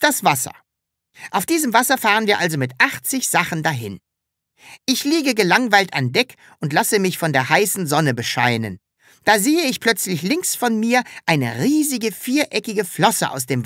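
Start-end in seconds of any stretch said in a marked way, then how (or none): none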